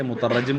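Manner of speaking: مترجم as "mutarajjim", ending in -jim; wrong pronunciation. Shown as a demonstration. Teacher saying no such form exists, wrong pronunciation. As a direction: say مترجم with a doubled j, "mu-ta-RAJ-jim". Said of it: "کوئی صیغہ ہی نہیں ہے".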